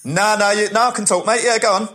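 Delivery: high-pitched, oblivious phone chatter; bloke on phone. Retold or imitated. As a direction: chat away on the phone mid-film, imitating him.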